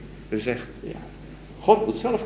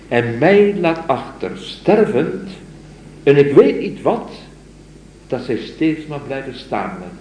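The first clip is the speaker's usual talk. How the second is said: raised, very slow lingering recitation; slow poem recital; 5 semitones higher, 3 dB louder, slower than usual. Acted. Lecturer first reading the stammering verse aloud, slow and raised. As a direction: draw each word out, rapt.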